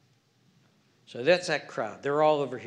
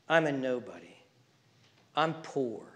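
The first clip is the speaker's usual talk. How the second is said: ordinary delivery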